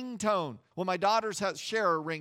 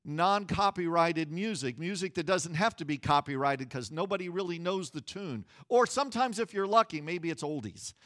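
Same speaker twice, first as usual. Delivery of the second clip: ordinary talk, nothing marked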